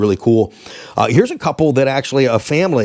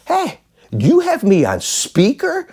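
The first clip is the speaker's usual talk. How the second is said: mock indignation; 13 semitones higher, slower than usual. Acted indignation at being on speaker.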